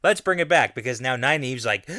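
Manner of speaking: normal talking voice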